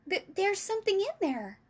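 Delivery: high, stammered cry of discovery; excited cry. A narrator's reading. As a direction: exclaim it high, like an amazed girl. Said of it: "cried out".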